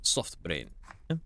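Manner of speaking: usual speaking voice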